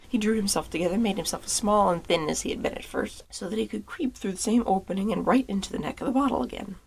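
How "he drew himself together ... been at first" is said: usual speaking voice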